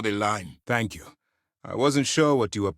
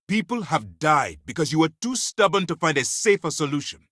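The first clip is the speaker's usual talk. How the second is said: higher, louder accusation; angry blame; 6 semitones higher, 5 dB louder than usual. Acted. Commander blaming stubborn agent for deaths, angry. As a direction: throw the blame at them forcefully.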